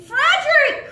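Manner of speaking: very high, loud "Frederick!"; overjoyed shout of the name; joyful cry. Acted. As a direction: cry out finding the lost bear. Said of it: "cried Jonathan".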